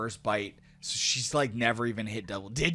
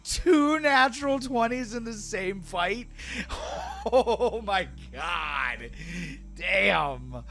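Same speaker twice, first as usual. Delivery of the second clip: disbelief; 10 semitones higher, 4 dB louder, slower than usual. Real, not acted. GM stunned by two natural twenties.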